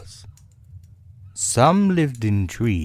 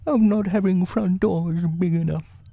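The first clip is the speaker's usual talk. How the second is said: high, grumbling bear voice; mildly cross storybook bear, pitched high; 12 semitones higher than usual. A narrator's reading. Pooh blaming the small door, high cross voice. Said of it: "said Boo crossly".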